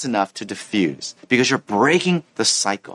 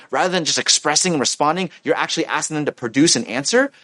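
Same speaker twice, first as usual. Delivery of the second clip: normal talking voice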